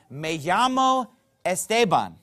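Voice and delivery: "Me llamo Esteban." said with Spanish sounds and "llamo" as /jamo/; Spanish pronunciation. Shown as a demonstration. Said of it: "the most common way to say that".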